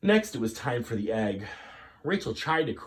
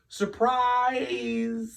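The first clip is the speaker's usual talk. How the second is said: high little squeak; 14 semitones higher, 4 dB louder than usual. Acted. Rachel greeting her parents, squeaking nervously. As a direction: squeak it out, trying to smile. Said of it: "squeaked Rachel".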